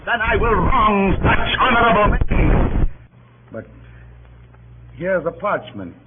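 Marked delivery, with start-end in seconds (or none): 0.1-2.9 loud, high, dragging out honorable; bitter irony. Acted. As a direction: hurl the word honorable back at them with scorn. Ironic jab at Brutus's honour.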